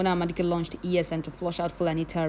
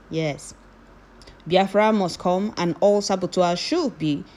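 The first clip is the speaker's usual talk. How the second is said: ordinary talk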